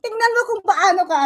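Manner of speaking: loud, high, rapid-fire retort; real agitated outburst, rushing; heated comeback. Real, not acted. Heated comeback in online quarrel.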